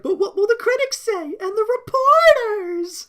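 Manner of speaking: high, loud, slow fretting Kimber; stretched high mock Kimber; imitating Kimber. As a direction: wail it like a worried diva. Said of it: "Kimber's just like".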